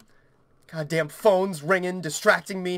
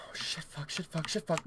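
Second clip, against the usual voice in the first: soft, breath-only cursing; whispered panic; 3 semitones lower, 13 dB quieter than usual. Real, not acted. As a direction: swear rapidly under your breath. Panicked swearing to self.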